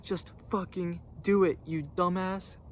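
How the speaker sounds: a hint of an irritated coworker; imitating angry partner. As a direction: play the annoyed partner brushing him off. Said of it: "'Cause he was mad".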